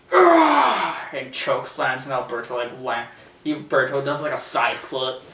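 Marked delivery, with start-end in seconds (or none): 0.1-1.0 loud, high effort roar; slam roar. Retold or imitated. Acting out the choke slam, loud effort roar. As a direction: roar as if heaving a man down.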